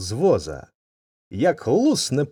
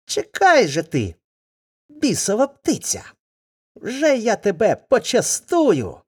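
put-on angry boy; 10 semitones higher than usual. Boy threatens the bird.

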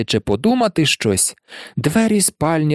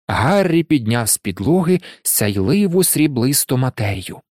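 usual speaking voice

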